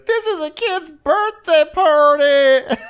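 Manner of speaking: very high, loud, stretched-out shriek; hammed-up outraged screech; mock screaming. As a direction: imitate a hysterical mother at full pitch. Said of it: "keeps screaming".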